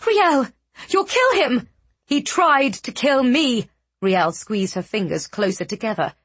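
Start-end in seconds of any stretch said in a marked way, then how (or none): 0.1-1.7 desperate scream. Acted. Ludovine screaming plea. 2.0-3.7 furious. Acted. Riel furious retort.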